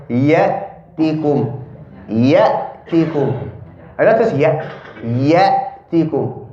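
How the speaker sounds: Ya'tikum slowly, the hamza deep in the throat; correct Ya'tikum. Shown as a demonstration. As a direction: drag Ya'tikum and drop into the throat. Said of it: "you have to go down".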